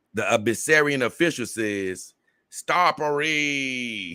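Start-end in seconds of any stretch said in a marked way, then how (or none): 2.5-4.1 long drawn-out, higher-pitched call; hammed-up, sustained cry with a very long final vowel; mock screamed-out call. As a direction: stretch the name out like a mock holler. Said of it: "He was just screaming out".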